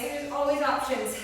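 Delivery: usual speaking voice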